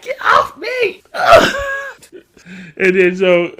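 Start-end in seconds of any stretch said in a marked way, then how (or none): 0.0-1.6 very high, slow strained squeal; mock squeal. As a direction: whine it out from under him. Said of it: "Kel's like".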